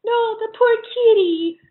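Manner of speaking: high, hammed-up pity for the kitty; mock pity. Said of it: "It's like".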